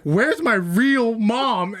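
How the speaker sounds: high, very drawn-out demand; insistent sleepwalker. Retold or imitated. Sleepwalker looking over at his brother, insistent.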